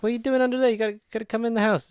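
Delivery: high, loud coaxing call; calling the dog. Retold or imitated. Farmer urging the dog under there to come in, loud high call. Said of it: "Like, like".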